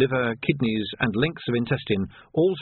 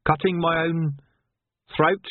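puzzled; 6 semitones higher than usual. Acted. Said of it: "said Vimes desperately".

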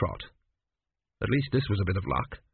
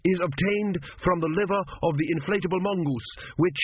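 higher, surer student voice; confident student; 7 semitones higher than usual. Acted. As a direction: press on more certain.

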